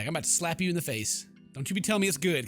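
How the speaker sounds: usual speaking voice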